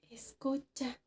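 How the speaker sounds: a short whispered order; whispering listen to the girl beside him. Retold or imitated. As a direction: whisper it, urgently. Omar telling her to listen.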